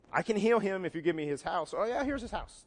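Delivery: hasty over-eager agreement, rising high at the end; a desperate parent agreeing to anything; mock blurted yes. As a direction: blurt it out without thinking. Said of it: "you just kind of blurt out an answer".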